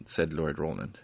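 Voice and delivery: plain reading voice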